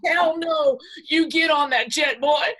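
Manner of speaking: loud, high playful command; mock shout. Wife ordering her husband onto the jet, shouting. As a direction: order him onto the jet, half joking.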